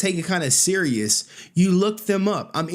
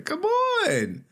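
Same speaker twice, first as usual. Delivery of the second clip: much higher, squeaky teasing come on; mocking come on; much slower than usual. Real, not acted. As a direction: pitch it way up and taunt. Host mocking the guest in the clip.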